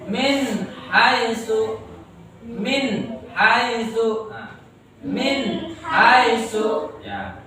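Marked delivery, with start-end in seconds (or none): 0.0-4.3 very slow "min haitsu", each part drawn out; stretched, careful "min haitsu"; clear recitation example. Shown as a demonstration. 5.0-7.0 very slow "min haitsu", each part drawn out; stretched, careful "min haitsu"; clear recitation example. Shown as a demonstration.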